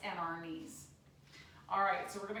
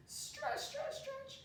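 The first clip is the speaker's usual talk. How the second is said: high coaxing call; 13 semitones higher, 7 dB quieter, much slower than usual. Shown as a demonstration. Coaching kids to stretch.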